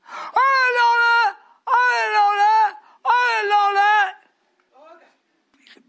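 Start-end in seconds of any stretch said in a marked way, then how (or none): 0.1-4.1 high, loud wailing shout, slowing on the last; crying shout. Retold or imitated. Preacher quoting neighbour, crying out loud. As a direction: cry it out in distress. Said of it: "Jonathan himself had called me".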